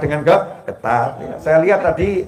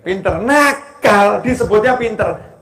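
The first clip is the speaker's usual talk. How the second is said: normal talking voice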